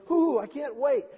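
loud, high burst of delight; eager anticipation. Acted. Preacher voicing humanity awaiting the second coming, thrilled. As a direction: bubble over with impatient excitement. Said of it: "There's a part of humanity going".

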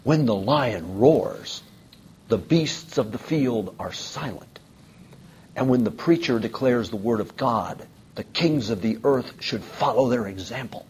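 slow, measured pronouncement, lightly acted; understated retelling of a preacher's pointed declaration; preacher's solemn rebuke. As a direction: deliver a deliberate, weighty rebuke with restraint. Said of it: "pointed his finger at him and said".

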